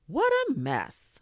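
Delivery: high, loud, slow exclamation; echoing exclamation. A narrator's reading. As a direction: boom it down from the sky. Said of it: "a voice echoed from above".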